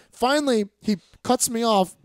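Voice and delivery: ordinary delivery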